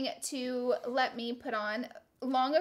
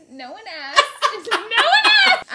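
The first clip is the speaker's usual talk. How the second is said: laughing; 9 semitones higher, 13 dB louder than usual. Real, not acted. Laughing at own joke.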